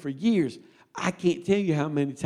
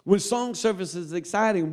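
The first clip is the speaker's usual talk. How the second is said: ordinary delivery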